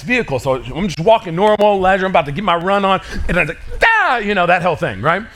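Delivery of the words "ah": sudden high yelp of fright; startled scream. Retold or imitated. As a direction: shriek like something jumped out at you. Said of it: "You've been scared like that before".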